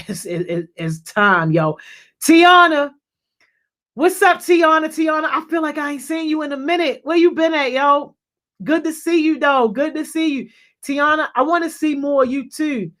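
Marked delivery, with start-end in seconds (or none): 2.2-2.9 loud, high, drawn-out name call. Real, not acted. Calling out to an arriving viewer. 3.9-12.9 high-pitched, delighted hello; excited greeting. Real, not acted. Greeting a viewer not seen lately.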